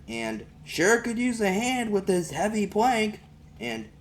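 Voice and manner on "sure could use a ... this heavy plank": high, loud hint tossed toward the house; a mock-loud remark aimed at the door; talking loud to myself. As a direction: fish for help by saying it too loud. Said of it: "talking loud to myself".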